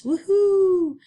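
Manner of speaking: high, loud woohoo; excited cheer. Real, not acted. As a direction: cheer like it's a party. Teacher cheers at grammar topic.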